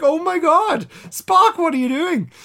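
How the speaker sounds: high, loud cry of disbelief; shocked outburst. Retold or imitated. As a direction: gasp it out at Spock, stunned. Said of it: "And I'm like".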